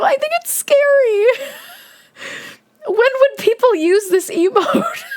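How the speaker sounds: high squeal of fright and laughter; squealing dismay. Real, not acted. Streamer squealing at the scary grandma emote.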